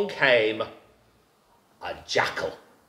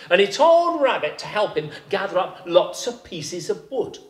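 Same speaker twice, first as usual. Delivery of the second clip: plain reading voice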